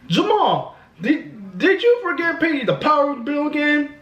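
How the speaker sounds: high-pitched mock woman voice; imitated lady. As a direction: question him in a put-on high lady voice. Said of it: "the lady was saying".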